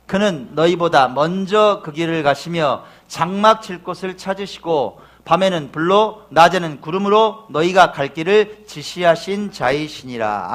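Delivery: slow, loud unison reading; slow scripture reading. Acted. 신명기 본문을 회중과 함께 낭독하며, 느린 낭독. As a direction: read the verse together, slowly.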